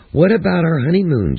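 bright, eager newlywed question. A narrator's reading. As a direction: ask it hopefully, lifted up. Husband asks eagerly.

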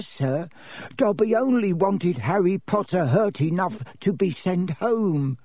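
high, squeaky little elf voice; high-voiced house elf explaining himself; Dobby the elf, earnest. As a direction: confess it in a high-pitched servile voice.

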